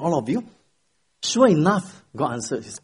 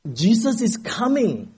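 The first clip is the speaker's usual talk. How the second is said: slow, high shouted proclamation; 8 semitones higher, slower than usual. Acted. Pastor leaning forward shouting at the congregation, full shout. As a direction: lean in and shout it out. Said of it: "he shouted".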